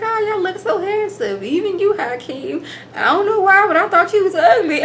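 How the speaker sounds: high, sweet grandma imitation, lightly done; mimicking Grandma complimenting the grooms; old grandma voice. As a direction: chirp it like a sweet, blunt old lady. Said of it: "She's like".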